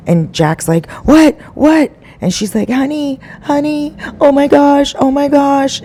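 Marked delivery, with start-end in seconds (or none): 1.0-1.9 high, loud startled "What? What?"; jolted awake and baffled, voice shooting up; startled confusion. Retold or imitated. Husband woken by his wife's scream, startled and confused. 2.7-5.8 high, hammed-up frightened wife; mock panic. Wife pretending to her husband that danger is near, hammed-up panic.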